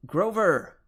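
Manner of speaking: loud, high call across the casino; full shout. Acted. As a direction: yell his name to snap him out of it. Shouting his name again.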